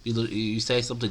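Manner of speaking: normal talking voice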